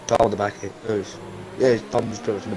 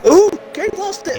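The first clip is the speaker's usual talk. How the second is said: excited; 5 dB louder than usual. Real, not acted. Crash seen during race commentary.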